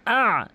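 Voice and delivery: আ pressed from mid-throat, drawn out; throat ayn. Shown as a demonstration. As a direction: squeeze a long আ from the middle of the throat. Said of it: "হলকের মাঝখান থেকে". (আ হলকের মাঝখান থেকে)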